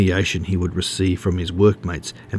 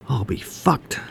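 higher-pitched, understated venom; venomous exclamation; 5 semitones higher, 4 dB quieter, slower than usual. A narrator's reading. Wood Duck reacts to the supposed spy, restrained venom. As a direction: let mild venom rise without shouting. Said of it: "thundered with increasing venom".

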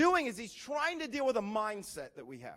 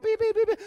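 high, loud electronic beeps; imitated detector beeps; 10 semitones higher, 8 dB louder, faster than usual. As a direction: sound like a detector going off. Detector going off over perfect family.

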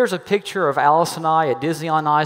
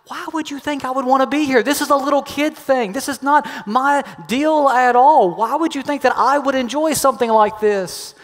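exasperated; 8 semitones higher than usual. Retold or imitated. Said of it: "And I was just like".

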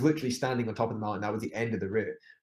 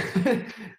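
laugh; 10 semitones higher than usual. Real, not acted. Laughing at own forced smile.

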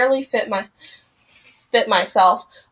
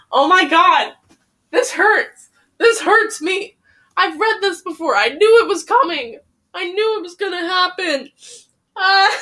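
higher, tearful voice breaking; real crying; 7 semitones higher than usual. Real, not acted. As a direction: let the tears come through the words. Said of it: "I'm almost crying".

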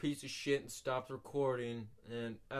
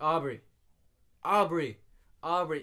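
calling a name louder each time; 3 semitones higher, 9 dB louder than usual. Retold or imitated. Calling to a classmate who ignores him, loud repeated call. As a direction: call her name across the room.

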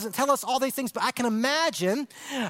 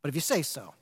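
low and softer, yielding; soft quiet surrender; 8 semitones lower, 6 dB quieter, faster than usual. Retold or imitated. Simon quietly giving in to Jesus. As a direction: let it fall quietly. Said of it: "a step of faith".

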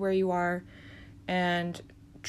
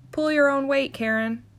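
sharp telling-off; 6 semitones higher, 4 dB louder than usual. Acted. Telling Karen off.

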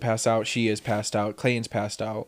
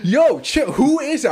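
alarmed call; 13 semitones higher, 5 dB louder, slower than usual. Retold or imitated. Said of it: "I was like".

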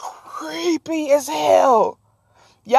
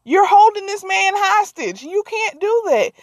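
shocked friend; 7 semitones higher than usual. Retold or imitated. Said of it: "Her friend was like".

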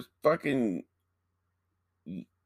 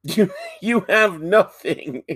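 mock taunt; 8 semitones higher, 5 dB louder than usual. Mock accusation.

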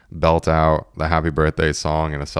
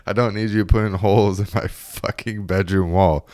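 lightly acted exasperated host, pitched up; mock exasperation; 4 semitones higher than usual. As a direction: scold a friend in half-amused disbelief. Smaller dude scolds friend over hole.

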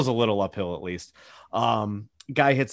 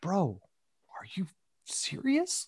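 whispered disbelief; 6 semitones higher, 8 dB quieter than usual. Retold or imitated. Reaction to golfer's outburst.

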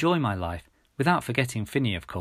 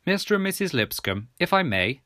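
English gentleman; 6 semitones higher than usual. A narrator's reading. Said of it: "the English gentleman interjected".

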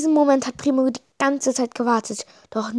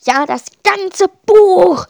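higher, louder, slow booming verdict; thundering; 8 semitones higher, 9 dB louder, slower than usual. A narrator's reading. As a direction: boom out the sentence like a judge. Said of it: "donnert Magelos".

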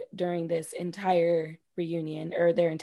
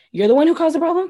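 pretend scolding; 9 semitones higher, 7 dB louder, faster than usual. Scolding Sharif in imagined quote.

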